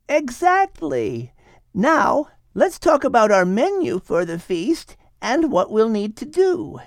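put-on lively young woman; Ada's voice. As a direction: voice Ada eagerly planning the party. Ada plans the feast.

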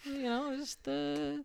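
evasive roommate. Acted. Roommate's evasive answer acted out.

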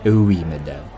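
very low waiter voice. Acted. Waiter conceding to madame, low waiter voice. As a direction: drop deep for the polite reply.

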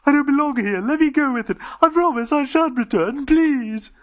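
high, loud desperate plea; Dodo crying out. Acted. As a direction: beg in panic. Dodo pleading.

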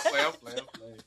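laughing. Real, not acted. Laughing off his joke.

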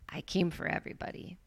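soft hushed whisper. Acted. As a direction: breathe it like a secret. Preacher stresses Jesus' message.

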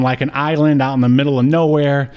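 ordinary talk, nothing marked

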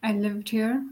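ordinary talk, nothing marked

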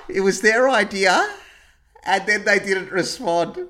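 mock outrage, disbelieving; incredulous. Real, not acted. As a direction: say it like you can't believe it.

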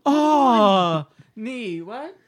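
high, loud drawn-out cry breaking into a question; hammed-up delivery-room groan turning puzzled; imitated grandma. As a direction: mimic grandma moaning out a name. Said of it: "if she was like".

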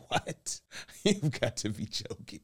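high, breathy laughing disbelief; shocked disbelief. Retold or imitated. Husband retelling his shock at wife's yes, laughing.

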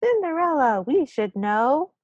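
high, drawn-out mock chorus; responding crowd. Crowd answering the gnome's question, mock chorus. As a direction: answer as a crowd, stretching the last words. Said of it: "And the crowd responds".

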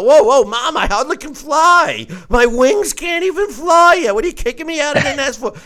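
high-pitched, whiny little bird begging Mom; baby bird pleading. Acted. Fledgling begging its mother not to kick it out, high and whiny. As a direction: squeak it like a chick who can't fly yet.

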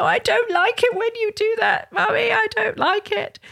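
high, plaintive imitation of a teary child; upset child. Upset child to a parent who shouted, imitated high and plaintive. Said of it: "they can be really upset".

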